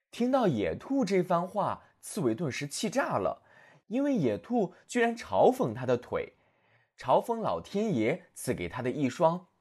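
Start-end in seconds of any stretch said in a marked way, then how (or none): none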